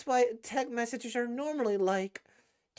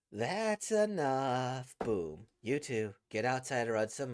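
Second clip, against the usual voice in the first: slow, lower stern command; stern coach; 10 semitones lower than usual. Acted. The coach breaks up the two girls' argument sternly. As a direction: draw it out like a tired coach.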